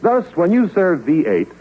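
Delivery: usual speaking voice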